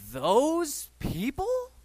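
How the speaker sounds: slow, high scornful those people; disdainful. Retold or imitated. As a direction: drag it out with disgust. Mocking critics' disdain.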